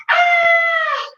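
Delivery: high shriek of fright; scream. Acted. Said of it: "Missus Watson screamed".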